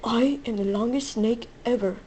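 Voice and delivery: usual speaking voice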